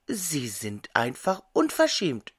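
ordinary delivery

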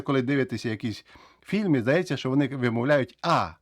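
normal talking voice

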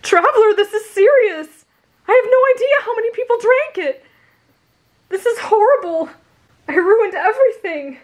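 high, loud, horrified pleading; distraught outburst. Acted. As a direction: panic as if disaster struck. Izuku distraught over the spill.

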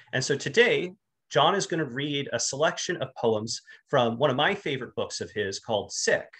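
ordinary talk, nothing marked

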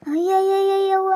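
slow, stretched-out ayyayyayyavva; drawn-out dismay. Acted. A woman arriving at the tap cries out in slow, drawn-out dismay. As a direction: drag out the cry of dismay.

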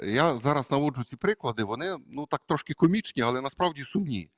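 usual speaking voice

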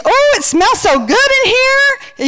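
very high, drawn-out coo; gushing delight. Retold or imitated. Friend coming home to cooking smells, high gushing delight. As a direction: gush slowly in an exaggerated high voice.

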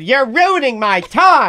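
put-on slow, deliberate scolding of a drunk heckler; wife slowly telling off a drunk woman at the show; retold stern rebuke. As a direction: lay each word down firmly like a fed-up wife. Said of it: "My wife stood up and said".

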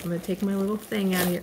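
normal talking voice

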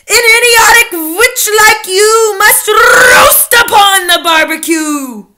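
loud, slow, high condemning chant; terrible witch recital. A narrator's reading. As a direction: roll out the sentence, loud and slow. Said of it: "continued with her terrible recital".

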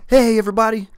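1 excited greeting. Real, not acted. Cheery host greeting opening show.

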